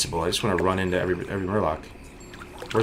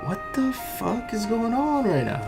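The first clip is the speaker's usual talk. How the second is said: stunned disbelief; 13 semitones higher, 5 dB quieter than usual. Real, not acted. Lucky loot disbelief.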